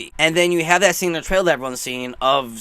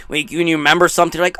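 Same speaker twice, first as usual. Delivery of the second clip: normal talking voice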